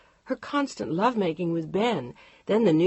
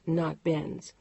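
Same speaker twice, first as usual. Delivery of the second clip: plain reading voice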